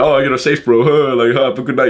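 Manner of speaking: placating wimp. Retold or imitated. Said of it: "he'll do this thing of".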